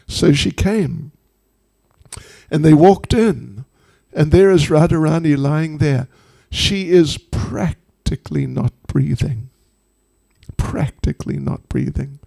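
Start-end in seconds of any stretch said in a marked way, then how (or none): none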